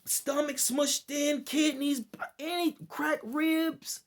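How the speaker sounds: high, loud, slowed disbelief; incredulous outcry. Real, not acted. Listing injuries to viewers, in a loud high outcry. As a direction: cry out each injury in shock. Reacting loudly to injury list.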